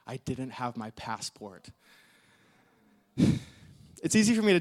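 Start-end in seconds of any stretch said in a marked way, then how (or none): 0.1-1.7 low, slow, quiet realisation; sinking dread. Retold or imitated. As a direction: let it sink in slowly and softly. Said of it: "that deep sense of".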